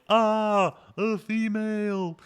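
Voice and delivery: high, drawn-out mock cry of horror; imitated shout. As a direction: stretch it out in a high, hammed-up wail. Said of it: "The way it's shouted is so".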